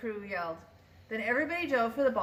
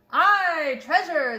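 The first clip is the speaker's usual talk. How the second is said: loud, higher shout of "treasure"; crew shouting; 6 semitones higher, 8 dB louder, much slower than usual. A narrator's reading. Pirate crew cheering about the treasure, shout. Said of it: "the others shouted".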